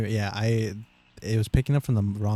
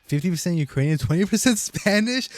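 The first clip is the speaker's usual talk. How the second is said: disbelieving rising question; incredulous; 8 semitones higher, 4 dB louder than usual. Real, not acted. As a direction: read it like you can't believe it. Reading friend's surprising result.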